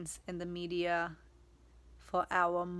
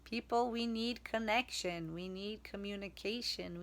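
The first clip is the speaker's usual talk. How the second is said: ordinary delivery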